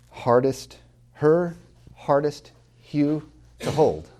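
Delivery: high, slow, h sounds leaned on; alliteration stressed. Shown as a demonstration. Professor savours the h alliteration for students, slow. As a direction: breathe out every h in "her hardest hue to hold". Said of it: "First, alliteration".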